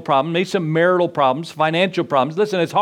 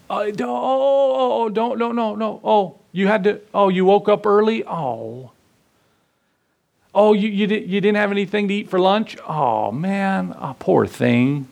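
mock coddling; 3 semitones higher than usual. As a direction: coo over him like a fussing mother. Pastor mimics God coddling him.

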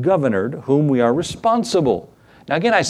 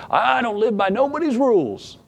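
higher, sneering rebel voice; defiant sinner; 9 semitones higher than usual. Retold or imitated. Preacher mocking a defiant sinner to the congregation, high and scornful. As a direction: toss it off like a cocky rebel.

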